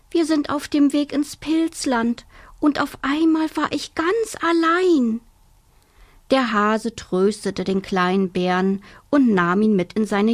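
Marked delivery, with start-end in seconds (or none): 0.1-5.2 put-on high, small tearful child voice, climbing higher; sobbing little bear. Lost little bear tells the hare, sobbing high. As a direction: sob it out like a lost cub. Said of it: "Da schluchzte der kleine Bär".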